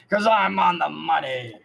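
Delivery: loud taunting villain line; taunting shout. Acted. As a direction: crow it over the fallen foe. Bandit killer taunts the corpse.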